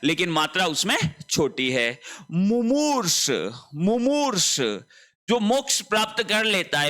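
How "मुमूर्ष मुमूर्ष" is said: मुमूर्ष said slowly with a short मु and a long stretched मू; high, slow teaching voice stretching मुमूर्ष; drawn-out demo. Shown as a demonstration. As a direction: spell out मुमूर्ष sound by sound, short then long vowel. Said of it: "मु पहले छोटे ऊ की मात्रा है".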